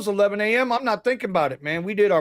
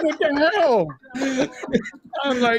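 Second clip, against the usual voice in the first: laughing; 7 semitones higher than usual. Real, not acted. Retells reaction while laughing.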